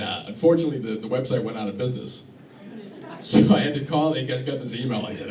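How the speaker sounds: ordinary delivery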